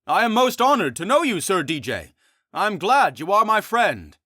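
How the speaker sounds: high, loud earnest friar; beaming pious friar. Acted. Friar speaks.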